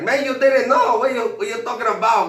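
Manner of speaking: put-on high, loud Mexican accent; laughing coworker. Coworkers tell him Lalo left, high and loud in an accent. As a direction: burst out at him like a mocking coworker. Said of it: "they started laughing".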